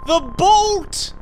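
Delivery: put-on high, straining old woman's shout; strained loud cry. A narrator's reading. Old woman struggling with the door, strained loud cry. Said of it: "strained and panting".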